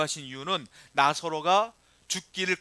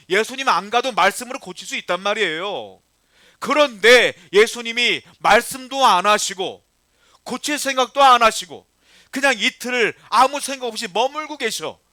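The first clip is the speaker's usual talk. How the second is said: emphatic preaching; 4 semitones higher, 8 dB louder than usual. Acted. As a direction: press the point on the congregation. Preacher builds to emphatic peak. (힘주어 외치는 설교)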